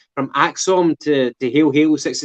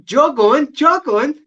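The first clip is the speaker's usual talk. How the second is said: a hint of a sneering posh voice, higher; mocking jog on; 10 semitones higher, slower than usual. Mocking a phrase he hates, lightly voiced snooty brush-off.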